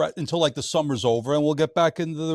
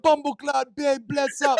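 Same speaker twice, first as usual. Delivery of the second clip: high, punchy imitation of Jamaican patois; mock patois; 11 semitones higher, slower than usual. Podcaster imitating his friend talking to a customer, mock Jamaican patois. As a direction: put on an exaggerated Jamaican patois. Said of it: "He starts speaking like patois".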